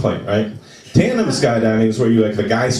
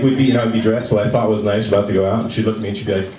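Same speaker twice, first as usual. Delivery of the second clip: ordinary talk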